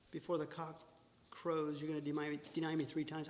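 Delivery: low, soft and sad; Jesus telling Peter softly and sadly; quiet voice. Shown as a demonstration. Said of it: "I don't think he yelled that".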